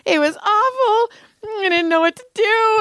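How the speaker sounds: high, whiny mock wail; mock distress. Telling Joel Berg the guards stopped her, mock distress. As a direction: play up being upset, voice way up.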